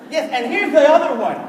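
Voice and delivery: ordinary delivery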